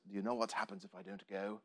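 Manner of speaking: put-on low, soft, lightly done Scottish accent; attempted Scottish accent. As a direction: try a hushed Scottish hero's accent. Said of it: "That's the best Scottish accent I have".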